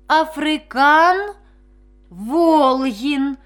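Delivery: halting, slow, stretched syllables; faltering. A narrator's reading. Vasyl repeats his made-up name to the doctor, faltering. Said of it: "вже запинаючись, вимовив Василь".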